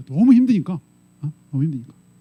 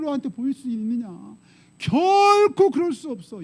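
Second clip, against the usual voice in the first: acted reproach, pitch climbing to the end; accusing friend; 4 semitones higher than usual. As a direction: play Job's insistent, blaming friend. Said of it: "친구한테 계속".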